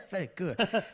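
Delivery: high laughing mock airline praise. Joking about Qantas with a friend, laughing mock praise. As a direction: giggle through a silly mock compliment.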